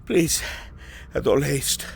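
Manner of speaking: urgent report broken by breaths; winded messenger. Acted. Runner warning the lord of a merchant's fear, breathless. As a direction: press for haste while short of breath.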